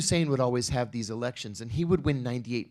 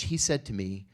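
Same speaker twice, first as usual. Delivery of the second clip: ordinary talk, nothing marked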